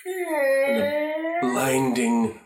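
dramatic slow build; drawn-out blinding. A narrator's reading. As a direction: stretch the word blinding, rising high. Narrator describes blinding light.

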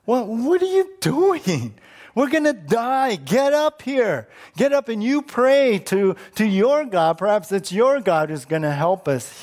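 high-pitched, urgent scolding; upset captain. Acted. Captain ordering Jonah up to pray in the storm, upset. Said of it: "you could tell he's kind of upset".

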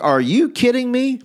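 high-pitched, incredulous retort; mock offended. Sleeper answers back to the threatening excuses, mock offended. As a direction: act affronted, pitched well up. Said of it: "like, offended".

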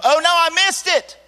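high, loud panicked outburst; panicked realisation. Retold or imitated. Man realising he missed the altar call, panicked. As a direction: blurt it out in alarm. Sudden panicked thought retold.

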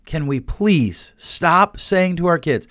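slow, drawn-out plea; dragged out. Shown as a demonstration. Begging parents to stop, slowed right down. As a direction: stretch each word out deliberately. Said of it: "I'm gonna drag it out for a second".

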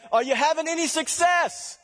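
high, loud call across the water; shouting from the shore. Acted. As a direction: holler from the beach to the boat. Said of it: "He hollers out".

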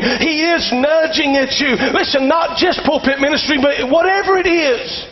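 high, emphatic pulpit delivery; preaching. Real, not acted. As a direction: preach it with rising pitch. Preaches to congregation.

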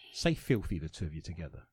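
soft whispered prompt; conspiratorial whisper. Acted. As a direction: whisper the cue to the others. Asks co-hosts to say filthy.

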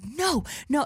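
high, urgent stop-him no; urgent intervention. Retold or imitated. Manager lunges at the dude to stop him, urgent.

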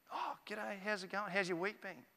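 easy, understated friendly hello; casual neighbourly greeting. Acted. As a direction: act out chatting over the garden fence. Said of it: "It was probably kinda like this".

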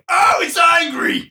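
high, slow, excited yell; excited shout. Pretend. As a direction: shout it out like a thrilled narrator. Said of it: "Why does this sound like a sex tape?".

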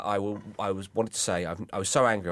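usual speaking voice

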